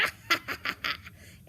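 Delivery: loud villain cackle; staccato wicked laughter; evil wolf laugh. Acted. Wolf reveals himself and pounces.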